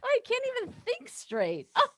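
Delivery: high, breathless, tumbling rush; a flustered girl who can't think straight; flustered nervous. Acted. Muriel, nervous meeting her old flame, high and breathless. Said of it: "I can't catch my breath".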